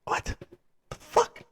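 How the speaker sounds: breathy stunned whisper, barely audible; whispered disbelief. Retold or imitated. Reviewer stunned by the scene, whispering in disbelief. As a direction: hush it out in shock. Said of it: "I was like".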